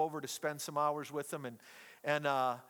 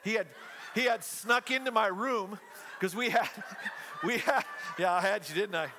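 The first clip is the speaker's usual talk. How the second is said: laughing; 3 semitones higher than usual. Real, not acted. Laughing at the reveal.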